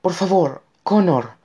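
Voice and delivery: high, pleading cry; terrified cry. A narrator's reading. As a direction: beg your son in fear. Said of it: "gritó ella aterrorizada". (grito aterrorizado, agudo)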